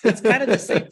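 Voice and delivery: high, laughing through it. Real, not acted. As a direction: still giggling while talking. Still laughing after the joke.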